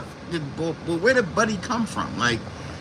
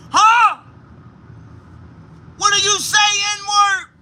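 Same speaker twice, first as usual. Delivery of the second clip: much higher mock yell; hostile calling out; 11 semitones higher than usual. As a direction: imitate shouting through a car window. Retold man shouting through window.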